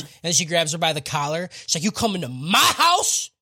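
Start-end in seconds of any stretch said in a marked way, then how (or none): 1.8-3.3 mock shout building higher and louder; hammed-up threat, climbing to a yell; retold angry confrontation. Hibana grabs her by the collar, angry retold yell.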